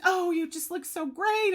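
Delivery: high, bright gushing coo; gushing compliment. Retold or imitated. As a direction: gush like a delighted older lady. Voicing the director's compliment.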